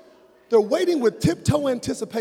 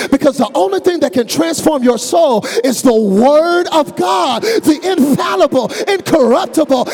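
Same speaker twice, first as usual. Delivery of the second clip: slow, loud, higher climax; full-force preaching; 8 semitones higher, 8 dB louder, slower than usual. Acted. Pastor to his church, rising to a shouted climax. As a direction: build it up to a shout.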